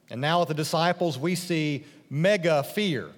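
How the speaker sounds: ordinary talk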